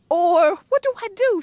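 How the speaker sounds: normal talking voice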